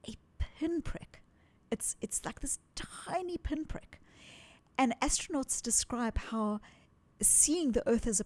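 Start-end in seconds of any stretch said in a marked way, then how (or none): 0.1-4.0 almost unvoiced, hushed wonder; hushed whisper. Real, not acted. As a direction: whisper it softly, full of awe. Earth seen as tiny pinprick.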